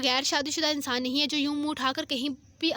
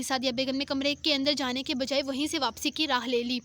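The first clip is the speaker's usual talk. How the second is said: usual speaking voice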